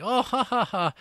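high, hammed-up fake laugh; mock laugh. Mary laughing off the threat, mock laugh. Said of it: "it's like".

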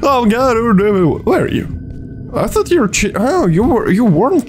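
high, panicky; panicked while being chased. Real, not acted.

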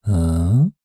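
usual speaking voice

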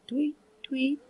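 soft, mournful bird chirp, barely there; a weak little cheep from a dying bird; faint mournful tweet. A narrator's reading. Dying lark in its cage, a faint mournful chirp. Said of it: "A faint and mournful".

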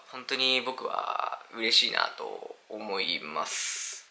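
very slow, soft and low, sincere; heartfelt gratitude. Real, not acted. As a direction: let the thanks out slowly and quietly. Heartfelt thanks to supporters.

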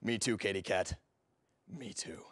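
low, soft seducer's voice; suave flirting. Acted. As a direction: linger on each phrase, low and flirtatious.

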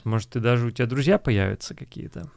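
usual speaking voice

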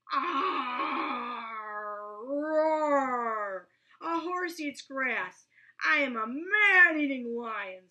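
loud roaring lion. A narrator's reading. Lion scaring Roy, loud roaring voice. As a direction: roar it out to scare him.

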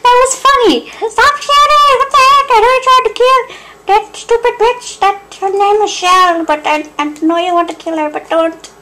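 very high, squeaky cartoon voice; squeaky character voice. Gamer voicing a squeaky character, very high and squeaky. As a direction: pitch it way up like a tiny creature.

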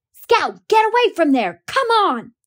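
put-on high, loud, desperate boy's shout; urgent yell. A narrator's reading. Boy screaming at his sister to get away from the house, high and loud. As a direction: yell to pull her away from danger. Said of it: "Jem was screaming".

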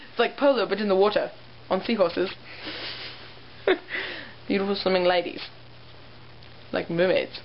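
lightly put-on New Zealand accent; joking Kiwi accent, held lightly. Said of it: "I'm so good at my New Zealand accent".

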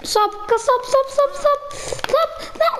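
rapid, high-pitched panicky repetition; panicked squeal. Real, not acted. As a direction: squeal in rising panic as the game turns on you. Panicking as fish attack in game.

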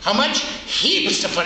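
ordinary delivery

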